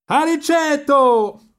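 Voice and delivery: very high, long-drawn call; shouting. A narrator's reading. Companions call Riccetto from the boat, very high long shout. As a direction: holler his name across the water. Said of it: "gli gridarono i compagni dalla barca".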